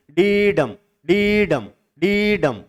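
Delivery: डीडम with a drawn-out डी, then short डम; long-short foot. Shown as a demonstration. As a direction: stretch the डी in डीडम and drop on a short डम. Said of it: "पहले वाले में अपन थोड़ा long ले रहे हैं".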